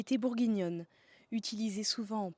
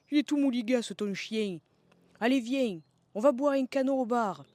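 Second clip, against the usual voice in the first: loud, sing-song Marseille twang; broad southern French accent; 4 semitones higher, 7 dB louder than usual. Acted. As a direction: call it out like an old Provençal villager. Voiced scene with old neighbours.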